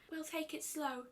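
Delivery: gentle and shy, barely above a hush; soft Fluttershy voice. A narrator's reading. Fluttershy reports on the climb, soft and timid.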